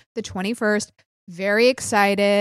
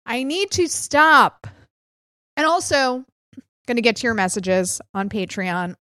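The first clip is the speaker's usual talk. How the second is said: ordinary delivery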